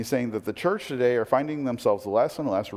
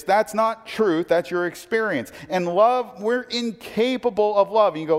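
ordinary talk

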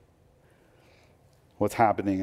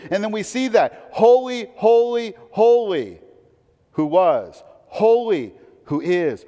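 higher, louder proclaiming of the angels' praise; fervent preaching; 10 semitones higher, 6 dB louder than usual. Acted. Pastor declaring the angels' song to congregation, soaring preaching. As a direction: proclaim holy, holy, holy like a herald.

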